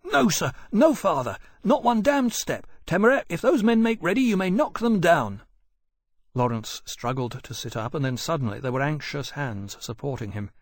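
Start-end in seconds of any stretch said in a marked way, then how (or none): 0.0-5.4 raised, fierce defiance. A narrator's reading. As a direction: stand your ground fiercely. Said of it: "Granby saying fiercely".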